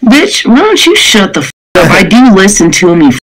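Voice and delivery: usual speaking voice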